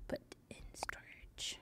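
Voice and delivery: whispered under her breath. Acted. Streamer repeating a game menu option to herself, whispered. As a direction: hush it out as a private echo.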